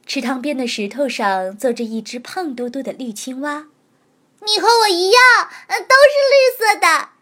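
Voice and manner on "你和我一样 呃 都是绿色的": put-on high, loud delighted baby voice; happy little creature. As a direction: cry out with glee. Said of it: "小家伙开心地叫了起来".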